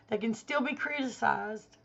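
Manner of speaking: ordinary talk, nothing marked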